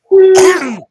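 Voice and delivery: harsh loud coughing fit. Real, not acted. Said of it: "I'm dying".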